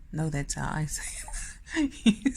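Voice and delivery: ordinary delivery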